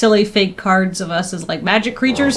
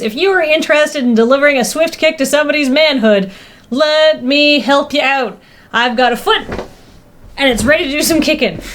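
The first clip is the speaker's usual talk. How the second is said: ad read voice; 4 semitones higher than usual. Acted. Reads sub commercial in character.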